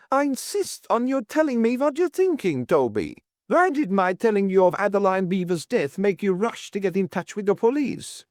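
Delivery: high-pitched, insistent outburst; bursting out. A narrator's reading. Landlady insisting Toby explain himself, high outburst. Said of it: "Missus Worth burst out".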